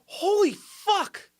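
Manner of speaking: high, slow, stunned exclamation; a drawn-out mock gasp of horror; shocked viewer. Imitating the viewer in Elysium, high, slow shock. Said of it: "you're like".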